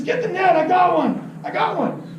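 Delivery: high, thrilled fisherman's cry; imitated urgent, high-pitched excitement; excited calling out. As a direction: act out a buddy hooked on a fish. Friend calls out with fish on.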